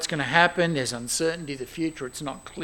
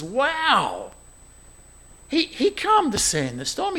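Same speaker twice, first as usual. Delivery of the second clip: ordinary talk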